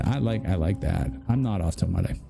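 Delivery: normal talking voice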